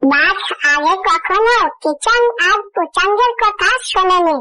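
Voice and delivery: plain reading voice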